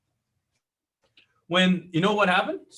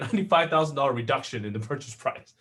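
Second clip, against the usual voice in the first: usual speaking voice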